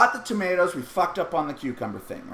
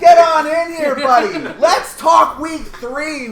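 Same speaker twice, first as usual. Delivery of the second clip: higher, louder, laughing invitation; excited welcome; 9 semitones higher, 11 dB louder, slower than usual. Real, not acted. Host invites Mike to join the podcast: higher, louder, laughing welcome. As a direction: beckon him in with glee.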